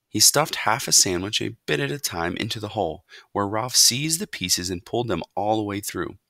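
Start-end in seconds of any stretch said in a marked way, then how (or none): none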